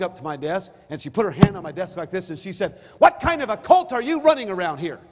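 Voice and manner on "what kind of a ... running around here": loud and higher, accusing; confrontational. Retold or imitated. Angry visitor confronting the pastor at his desk, loudly. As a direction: demand it loudly, hand on the desk.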